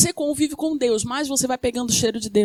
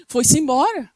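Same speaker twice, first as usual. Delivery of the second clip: ordinary delivery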